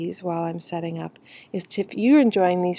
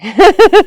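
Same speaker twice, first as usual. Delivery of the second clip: loud high burst of laughter; real, amused laugh at herself; 12 semitones higher, 18 dB louder than usual. Real, not acted. Laughs at maybe being alone.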